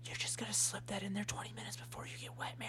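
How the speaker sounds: hushed, scandalised whisper; shocked whisper. Acted. Sister shocked by the pregnancy news, whispering. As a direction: hiss the scandal under your breath.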